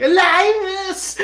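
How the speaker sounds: high-pitched mock scream, drawn out; Jeff Hardy screaming. Imitating a wrestler after a car accident, screaming. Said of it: "followed by Jeff Hardy screaming".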